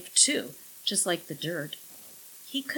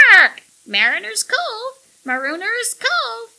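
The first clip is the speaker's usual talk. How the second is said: very high, loud parrot squawk; parrot proclaiming; 11 dB louder than usual. A narrator's reading. Parrot defying Millie, loud squawk. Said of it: "the bird unobediently proclaimed".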